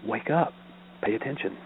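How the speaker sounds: hushed nudge to a stranger; quiet, unforced reminder; gentle admonition. Retold or imitated. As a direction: softly urge them to take notice. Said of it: "I say gently, not rudely".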